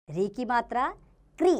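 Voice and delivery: usual speaking voice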